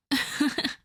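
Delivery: short mocking laugh under the breath; a smug little laugh; teasing chuckle. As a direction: chuckle at their weakness. Vampire taunts listener.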